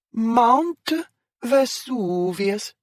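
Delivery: slow, high stage-Italian Vesuvius; Italian accent. Acted. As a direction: draw out Vesuvius in an overdone Italian way. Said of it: "in an odd accent".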